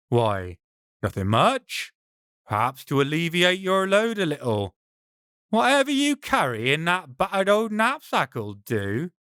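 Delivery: lightly voiced brigand, mock-innocent; a hint of a greedy highwayman. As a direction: shrug it off while eyeing the bag. Brigand answers Ben.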